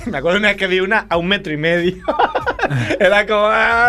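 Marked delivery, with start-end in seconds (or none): 2.5-3.9 laughs out the words; half-laughing, a bit high. Real, not acted. Laughing retelling.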